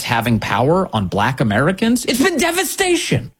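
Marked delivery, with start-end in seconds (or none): 2.0-3.3 high stretched it's BEEN devastation; emphatic devastation. Real, not acted. Pundit stressing the cost to viewers, high and emphatic.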